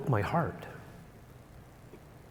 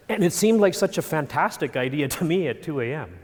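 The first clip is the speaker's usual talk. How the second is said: ordinary talk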